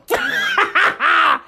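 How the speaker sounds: high, rapid cackling laugh; big laugh. Real, not acted. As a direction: crack up at your own bit. Laughs at own impression.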